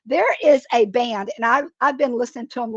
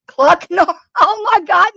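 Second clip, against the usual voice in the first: laughing hard; 8 semitones higher, 8 dB louder than usual. Real, not acted. Laughing at a viewer's name idea.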